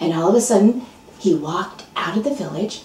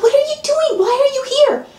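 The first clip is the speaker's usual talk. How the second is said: very high startled questions; jolted awake and baffled; startled farmer. Acted. Farmer woken at night by the neighbor, high startled voice.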